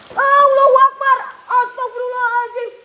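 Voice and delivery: jolted by a sudden fright; startled cry. Real, not acted. Startled during ghost hunt. (seruan kaget, tinggi dan keras)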